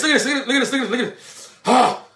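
ordinary delivery

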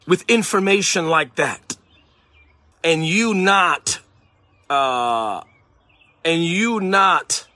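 usual speaking voice

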